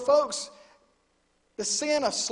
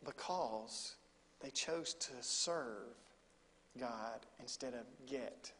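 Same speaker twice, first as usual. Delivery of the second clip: much softer, slowed, each word weighed; hushed and slow; 7 semitones lower, 11 dB quieter, slower than usual. Real, not acted. Preacher to congregation, soft and slow. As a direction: lay it down gently.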